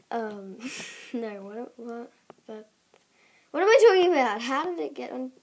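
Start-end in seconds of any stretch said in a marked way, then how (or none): 3.5-5.3 high startled exclamation; surprised. Real, not acted. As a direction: suddenly blurt it out, alarmed. Sudden confused outburst.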